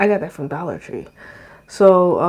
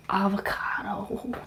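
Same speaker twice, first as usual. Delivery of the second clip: whispered, slow and longing; dreamy whisper; 10 dB quieter, slower than usual. Real, not acted. Swooning to viewers over avocado, a slow whisper. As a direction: sigh the word avocado like a love name.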